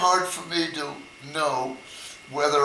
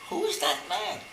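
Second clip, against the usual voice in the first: much higher, lightly voiced gossiping lady; curious old ladies; 14 semitones higher, 4 dB quieter than usual. Ladies at the window wondering about the stranger. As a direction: peer out and wonder in a lady's voice.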